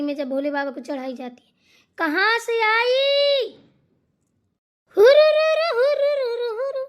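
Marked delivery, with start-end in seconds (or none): 2.0-3.5 put-on high, loud, very slow drunken cry; drunken wail. Intoxicated woman on bhang. 5.0-6.8 very high, loud rolling hoots; drunken hooting trill. Acted. Intoxicated woman hooting.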